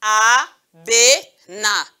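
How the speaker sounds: "abena" very slowly as a, be, na; long pauses between the syllables of "abena". Shown as a demonstration. Teacher reading with pupils, "abena" split into three slow syllables. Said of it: "Nous lisons encore ensemble".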